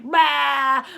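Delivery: loud, goofy bleat; silly "bah". Shown as a demonstration. Teacher showing kids silly faces, silly bleat. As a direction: blurt it out with your tongue out. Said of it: "when I'm being silly".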